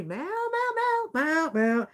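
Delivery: high mock meows like her kitten; cat meowing. Mimicking her kitten asking for bed. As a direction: meow up high like a demanding cat. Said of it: "she talks to me".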